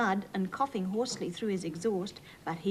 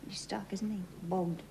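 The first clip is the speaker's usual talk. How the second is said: whispered aside; 7 dB quieter than usual. Real, not acted. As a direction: confide it in a whisper. Aside to listening children.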